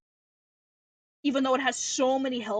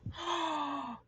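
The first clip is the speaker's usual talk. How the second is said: startled sharp intake of breath; shocked gasp; 4 semitones higher, 6 dB quieter than usual. Real, not acted. Spots hair in fish tank.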